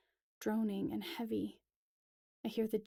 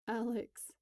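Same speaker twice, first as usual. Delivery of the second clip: a mild tearful whimper. Acted. Steph kneeling in defeat, mildly whimpering. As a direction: say her name on the edge of a sob. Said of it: "she whimpers".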